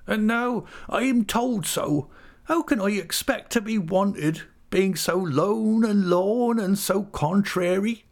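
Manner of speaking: very high, drawn-out self-pitying whine; pitiable whimper. A narrator's reading. Missus Gummidge feeling unwanted, high pitiable whimper. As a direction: whimper like a forlorn widow. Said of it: "with a pitiable whimper".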